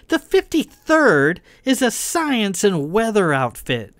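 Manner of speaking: high, loud belittling jab; scornful taunt. A narrator's reading. Kai belittling Verlin's regiment, scornful.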